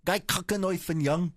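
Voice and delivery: put-on Afrikaans phrases in South African accent. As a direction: bark in mock Afrikaans. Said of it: "That was a South African accent".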